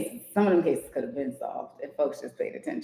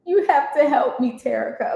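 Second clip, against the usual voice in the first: high, loud desperate plea; frantic plea; 11 semitones higher, 7 dB louder than usual. Acted. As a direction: beg like he's panicking. Hysterical manager line read aloud.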